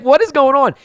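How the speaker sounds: high, loud cry of disbelief; baffled yell. Retold or imitated. Retelling his shock at the broadcast, baffled high yell. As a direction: yell it in exaggerated bewilderment.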